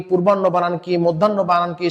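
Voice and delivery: ordinary delivery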